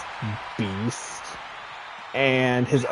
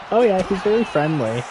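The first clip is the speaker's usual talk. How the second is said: higher, louder, quick friendly owner; bright owner voice; 9 semitones higher, 5 dB louder, faster than usual. Retold or imitated. The owner reassuring the speaker, higher and louder. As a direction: chirp it like a cheerful dog owner.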